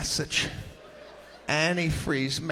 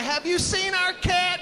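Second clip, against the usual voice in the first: worried neighbor; 14 semitones higher than usual. Retold or imitated. Neighbor asking around for a lost cat, high anxious call.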